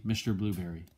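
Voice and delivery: usual speaking voice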